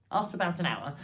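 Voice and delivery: plain reading voice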